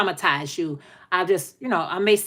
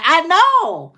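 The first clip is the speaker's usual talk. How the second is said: mock scream; 8 semitones higher, 5 dB louder than usual. Mimicking screaming inside.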